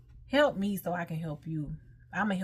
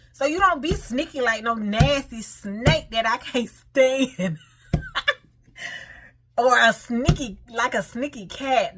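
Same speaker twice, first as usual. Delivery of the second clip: laughing; 4 semitones higher, 6 dB louder than usual. Real, not acted. Joking about sneaky nasty snakes.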